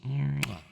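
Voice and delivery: high, loud "mwah"; kiss sound. Real, not acted. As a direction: plant a big smacking kiss. Kiss after greeting a viewer.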